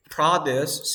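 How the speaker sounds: Produce said PRO-duce, drawn out; slow, front-stressed PRO-duce for Produce; stress on first syllable. Shown as a demonstration. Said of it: "the stress is in the first syllable".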